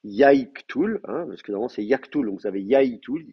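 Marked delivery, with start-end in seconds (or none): 0.0-1.0 "Yai ktul" with a clear yai diphthong; diphthong shown. Shown as a demonstration. Teacher showing students the uncontracted form, "Yai ktul" with full diphthong. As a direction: sound out both parts of yai in "Yai ktul". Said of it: "une contraction de la diphtongue yai".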